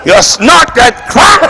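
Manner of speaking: slow laughing shout. Real, not acted. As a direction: yell it through laughter.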